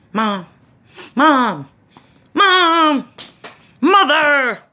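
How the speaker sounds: calling out, growing from plain to a high, loud yell; impatient kid whose calls climb into a high, loud holler; kid calling mom. Retold or imitated. Child calling for mom, building.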